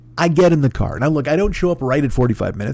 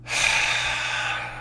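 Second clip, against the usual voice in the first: heavy sigh; 7 dB quieter than usual. Real, not acted. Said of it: "the sigh that I let out".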